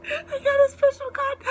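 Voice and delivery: high, loud joyful cry; real ecstatic squeal; overjoyed squeal. Real, not acted. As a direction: burst out with disbelieving joy. Overjoyed at rare card.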